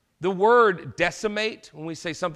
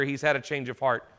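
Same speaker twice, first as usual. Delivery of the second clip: usual speaking voice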